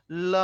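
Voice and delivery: "ল" said alone and held; isolated, drawn-out "ল" for repeating; lateral sound demo. Shown as a demonstration. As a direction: hold the l of "ল", tongue behind upper teeth. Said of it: "আমার সাথে বলো".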